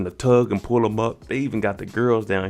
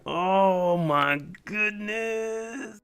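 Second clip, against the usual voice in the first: high, very slow, drawn-out oh my goodness; horrified gasp; 9 semitones higher, much slower than usual. Real, not acted. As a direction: stretch it out in disbelief. Recapper's horror at the pinky.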